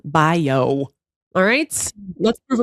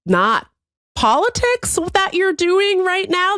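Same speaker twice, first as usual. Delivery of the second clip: exasperated; 10 semitones higher, slower than usual. Retold or imitated. Retelling her own angry retort.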